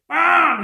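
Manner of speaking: loud, drawn-out "pam"; a big booming impact noise; imitated crash. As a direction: shout the crash of a sudden impact. Imitates light crashing down from heaven.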